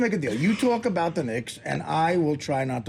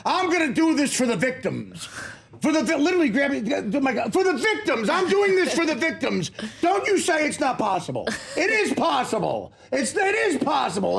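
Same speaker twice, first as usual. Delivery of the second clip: mock bluster, high and insistent; hammed-up outraged shouting, pitched high; pompous yelling; 8 semitones higher than usual. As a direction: bellow it like a grandstanding bigwig. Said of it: "Dolan literally going".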